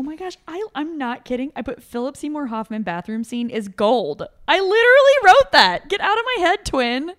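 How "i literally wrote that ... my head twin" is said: high, loud and delighted; squealing that her friend wrote the same note; excited disbelief. Real, not acted. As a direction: shout it in happy shock at the coincidence. Amazed they wrote the same note.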